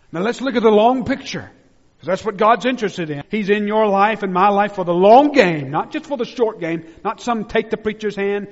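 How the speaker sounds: loud, high, ringing pulpit voice; full-force preaching. Acted. Pastor preaching hard to his church, loud and high. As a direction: preach it out with fire to the congregation.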